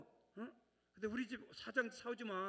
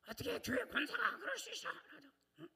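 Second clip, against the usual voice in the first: scandalized deaconess; 10 semitones higher than usual. Retold or imitated. Mimicking a scandalized churchgoer.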